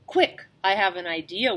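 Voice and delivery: usual speaking voice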